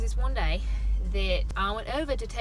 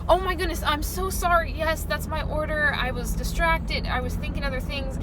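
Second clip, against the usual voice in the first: apologetic; 7 semitones higher, 4 dB louder than usual. Retold or imitated. Distracted drive-through customer apologising to the worker, apologetic. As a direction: retell a profuse, sweet apology. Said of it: "She was so apologetic and so sweet".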